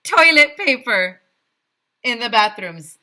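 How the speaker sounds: high, loud proclamation; mayor proclaiming loudly; loud decree. A narrator's reading. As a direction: boom out the rule. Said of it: "the mayor continued".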